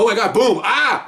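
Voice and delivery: high startled outburst, acted out; hammed-up shock with a cry; mock panic. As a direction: jump as if the gun just went off. Acting out an accidental shot.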